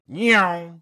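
high, loud imitated car speeding off; playful car sound; mock engine zoom. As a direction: make the noise of a car peeling away. Car driving off noise.

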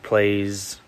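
normal talking voice

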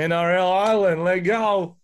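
loud, high hype call; hyped announcer. Pretend. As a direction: shout it like a sports promo.

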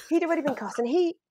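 scandalised, hushed-but-high punchline; incredulous. Retold or imitated. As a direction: squeal it in comic disbelief. Comic disbelief at colleague's poor accent.

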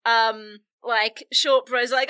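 ordinary delivery

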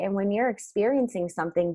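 usual speaking voice

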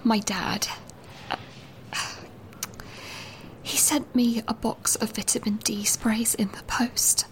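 hushed whisper so no one hears; whispering secretly. Acted. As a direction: whisper it, afraid of being overheard. I've come into the loo because it's easier.

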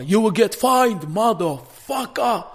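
hammed-up furious heckle; angry audience member. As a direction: yell it out slowly as the offended man. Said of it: "svart man som reser sig upp och bara".

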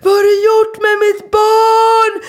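high, loud mock scream at the barber; retold scream. Mother screaming at the barber over her son's haircut, outraged yell. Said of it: "jag skrek".

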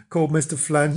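ordinary delivery

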